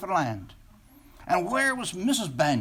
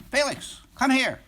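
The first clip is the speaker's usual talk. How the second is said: bossy summons; 7 semitones higher, 4 dB louder than usual. Acted. Student president summons the boy.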